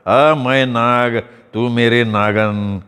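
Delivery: put-on very slow, drawn-out drunk mumbling; drunken babble. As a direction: drag each word out, drunk and dreamy. Drunk charmer keeps babbling.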